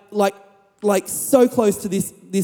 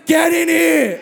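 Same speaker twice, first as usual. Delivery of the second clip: neighbour's shout; 7 semitones higher, 3 dB louder, slower than usual. Retold or imitated. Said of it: "And he says".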